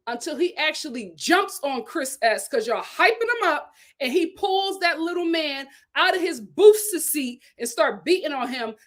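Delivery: ordinary delivery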